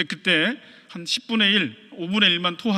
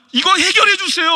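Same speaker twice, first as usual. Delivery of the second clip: much higher, louder anguished prayer; raw prayer; 10 semitones higher, 7 dB louder than usual. Retold or imitated. A believer pouring out his heart to God, anguished cry. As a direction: pour out the hurt to God.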